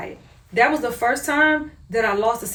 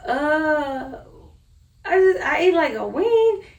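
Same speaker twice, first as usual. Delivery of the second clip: high-pitched, hesitant mock-guilty admission; sheepish confession; 6 semitones higher, slower than usual. Wife owns up to husband about eating wheat, sheepish. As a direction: drag out the uh, then squeak out the excuse.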